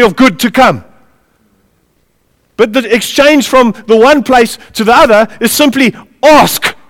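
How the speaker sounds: high, loud, punchy pulpit delivery; full-force preaching. Real, not acted. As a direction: preach it with driving emphasis.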